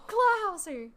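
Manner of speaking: loud, high announcement; announcing. Acted. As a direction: call out the house name. Announcing sorting result aloud.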